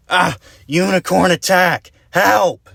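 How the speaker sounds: high, loud terrified yell; shrieking unicorn alarm; panicked scream. Acted. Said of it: "he screamed as he ran away".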